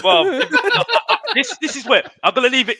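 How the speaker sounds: high laughing before he speaks. Real, not acted. Laughing at his mates before making a point. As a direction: laugh it off as you go.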